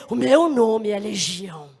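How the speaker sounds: low, slow, drawn-out menace; name yourself slowly and low, as a demon. Acted. Demon forced to confess its name to God, low slow voice. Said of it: "Ele vai dizer".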